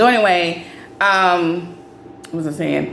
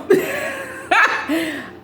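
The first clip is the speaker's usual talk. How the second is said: a high burst of real laughter. Real, not acted. Laughing at being called Doug's homegirl, high laugh. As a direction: laugh it off in disbelief.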